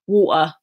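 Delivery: "Water" with no T in the middle; Cockney "Water", T left out; dropped T. Shown as a demonstration. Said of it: "they drop T's".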